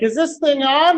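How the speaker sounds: mock-confused calling out over the tool; puzzled dentist. Dentist asking about his tool, loud high imitated voice. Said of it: "and he's like".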